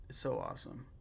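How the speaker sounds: ordinary talk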